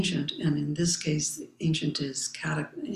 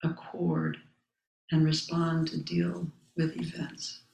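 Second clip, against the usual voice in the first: solemn text recitation. Acted. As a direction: recite each phrase with space around it. Reading the Zen text aloud.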